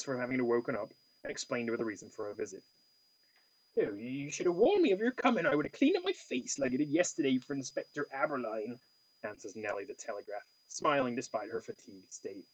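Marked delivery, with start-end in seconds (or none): 3.8-8.8 lightly voiced Cornish woman; a touch of Cornwall in the vowels; Cornwall accent. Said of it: "I gave her, like, a Cornwall accent".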